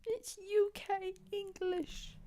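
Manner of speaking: high, slow, prim voice; mock prissy. Correcting the commenter in a high, slow, prim voice. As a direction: draw it out up high.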